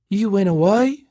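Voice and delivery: high, thin disbelieving question rising at the end. A narrator's reading. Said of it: "she said in a thin voice".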